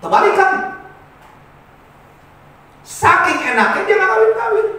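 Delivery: high, loud, incredulous-sounding sermon riff; animated loud preaching. Real, not acted. Preacher contrasting foreign marriage customs with ours, high animated preaching. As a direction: pitch it up and hammer the contrast.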